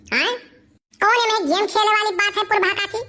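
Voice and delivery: ordinary talk, nothing marked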